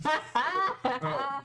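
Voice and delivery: high thrilled gasp; delighted character voice. Acted.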